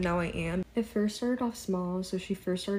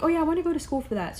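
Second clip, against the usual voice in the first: higher, fast mock coworker voice; imitating coworker; 9 semitones higher, 5 dB louder, faster than usual. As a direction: rattle it off in her higher voice. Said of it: "she's like".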